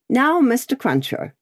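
brisk high spinster voice; a lightly voiced brisk governess; Miss Pross voice. A narrator's reading.

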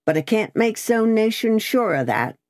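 high, lightly gruff grumble; gruff soliloquy. A narrator's reading. Guard doubts the rider to himself, mildly gruff soliloquy. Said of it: "said the guard in gruff soliloquy".

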